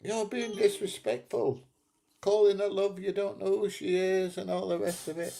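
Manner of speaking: high mock prissy voice; mocking southern woman. Mimicking a southern woman who complained, high and mocking. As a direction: send up the complaining woman, pitched well up. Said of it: "some southern women was going".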